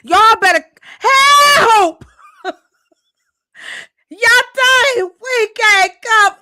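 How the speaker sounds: loud, high, half-crying yell; excited yell. Real, not acted. Host yelling to viewers about snow, high and loud. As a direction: yell out over the snow news.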